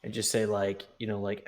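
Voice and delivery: ordinary talk, nothing marked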